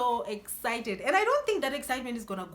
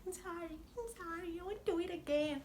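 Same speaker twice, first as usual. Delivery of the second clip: soft, high sheepish apology; meek apology; 7 semitones higher, 9 dB quieter than usual. Retold or imitated. She apologizes to her scolding dad, soft and sheepish. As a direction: mumble sorry like a scolded kid. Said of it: "I'm like".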